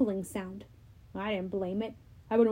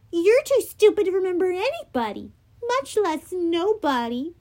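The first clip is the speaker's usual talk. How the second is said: taunting; 7 semitones higher than usual. Acted. Annabeth taunting.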